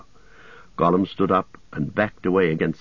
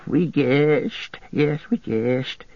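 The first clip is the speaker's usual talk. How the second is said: a higher, slower creature voice; whispered; 6 semitones higher, much slower than usual. A narrator's reading. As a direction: Gollum confessing slyly, high and slow. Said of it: "he whispered".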